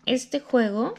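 ordinary talk

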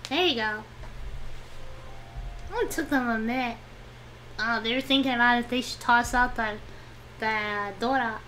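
ordinary delivery

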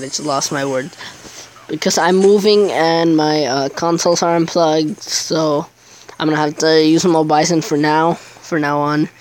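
ordinary talk

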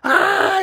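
very high shrieking wail; high wailing yell. A narrator's reading. Boy mortified at the mall, shrieking wail. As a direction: let out an anguished high cry. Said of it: "yelled Andrew".

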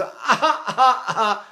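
short repeated "ah" wails; baby cry. Shown as a demonstration. Teacher shows children allowed to cry, short open wails. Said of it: "let them cry all they want".

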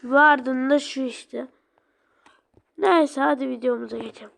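ordinary talk, nothing marked

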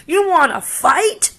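high, loud taunt with a rising end; bully's dare. Acted. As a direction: dare him to fight. Grouchy ladybug picks fight.

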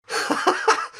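high mocking girl's laugh; wild giggle. A narrator's reading. Noémie laughing at the boy's tide story, high mocking giggle. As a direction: burst out laughing like a mad thing. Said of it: "se met à rire comme une folle".

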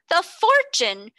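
shocked cry. A narrator's reading. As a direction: struck pale by the news. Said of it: "cried Miss Minchin".

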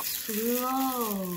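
drawn-out hushed slow; slow and soft. Shown as a demonstration. To a toddler, showing slow with a soft stretched word. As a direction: let the word sink low and soft. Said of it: "Fast and slow are opposites".

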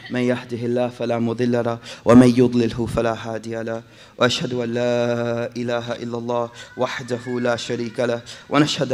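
fast formulaic Arabic recitation; rapid khutbah formula. Acted. Preacher reciting the khutbah formula, fast and steady. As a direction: rattle off the opening formula.

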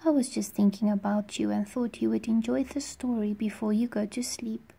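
put-on hushed, breathy hello to sleepy kids; gentle whispered bedtime greeting; soft bedtime whisper. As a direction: whisper it like tucking children in.

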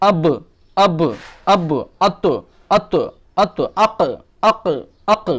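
আব, আত, আক each ending in a bouncing echoed stop; qalqala echo. Shown as a demonstration. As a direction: release the final ব, ত, ক with a small rebound. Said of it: "একটু প্রতিধ্বনি হয়ে বেরিয়ে আসবে". (শেষের ব, ত, ক প্রতিধ্বনি দিয়ে উচ্চারণ)

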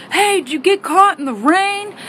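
high, teasing coworker voice; mocking coworkers. Coworkers teasing mom about her hair, high mocking. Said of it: "people are like".

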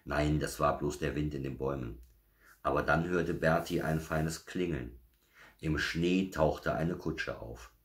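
ordinary delivery

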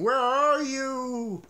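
loud, drawn-out spooky call; a muffled monster calling for Frog; a loud, slow ghostly call; eerie call. A narrator's reading. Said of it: "cried the thing".

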